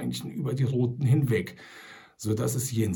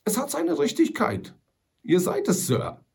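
put-on higher, louder, relieved old trapper; 9 semitones higher, 4 dB louder than usual. A narrator's reading. Sam Hawkins recognizes the rifle and Old Shatterhand, relieved. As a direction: sound relieved at last.

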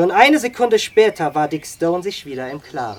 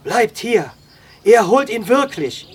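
higher, insistent entreaty; urgent plea; 5 semitones higher, 6 dB louder than usual. A narrator's reading. Wolf grabbing Dick's arm to hold him back, pleading. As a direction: plead with force. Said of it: "und bat".

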